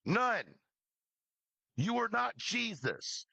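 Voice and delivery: ordinary delivery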